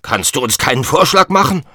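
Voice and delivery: impatient tyrant demanding a suggestion; impatient king. Acted. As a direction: pressure the jester for an idea.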